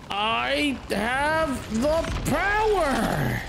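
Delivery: slow, grand proclamation; triumphant hero voice. Pretend. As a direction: declare it like a champion.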